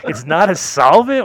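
high, loud, slow mimicked protest; mock insistent denial. Dealer insisting to sceptical smokers, mock indignant denial. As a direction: drag out the denial, amused and hammed-up.

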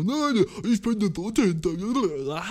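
mocking the gym guy. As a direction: drag it out like a smug dope. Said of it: "und er war so".

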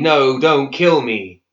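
slow, loud desperate cry; screaming. Acted. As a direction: beg for your life. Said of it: "and then screaming".

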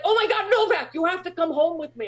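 high, excited imitation of a fan; starstruck fan. Beach vendor recognizes the star and invites them home, high gushing excitement.

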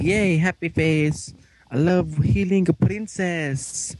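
slow, high, drawn-out cheer; mock cheering. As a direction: gush slowly in a put-on high voice. Mock cheer over radio show.

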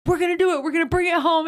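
imitating an emotional pep talk; mock fired-up brother. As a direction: hype him up, lightly acted.

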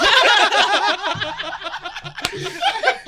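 long, high burst of laughter; loud laughter. Real, not acted. Storyteller after punchline, laughing hard. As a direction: crack up at your own story.

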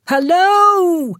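high, loud yell; loud, high call for someone gone. A narrator's reading. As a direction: shout into the empty room. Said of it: "I yelled".

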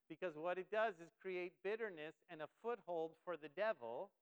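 ordinary delivery

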